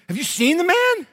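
high, loud, disbelieving; can you believe this guy; incredulous. Retold or imitated. Soldiers of Israel telling David about Goliath, high incredulous exclaim. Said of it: "the men of Israel said".

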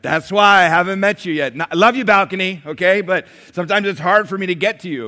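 loud, high call up to the balcony. Real, not acted. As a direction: shout warmly up to the back rows. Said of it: "calling to balcony".

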